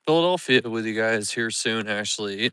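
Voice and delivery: usual speaking voice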